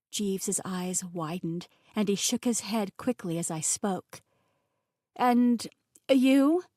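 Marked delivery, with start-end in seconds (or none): 5.1-6.6 halting, raised and louder; stuttering. A narrator's reading. Narrator as understanding dawns about chef's name, stuttering. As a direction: stumble to a stop between words. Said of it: "I stuttered over my words".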